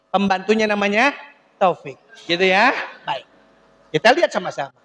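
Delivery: ordinary talk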